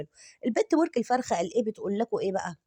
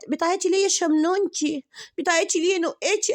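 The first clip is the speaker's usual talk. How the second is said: mock coddling; 9 semitones higher, 4 dB louder than usual.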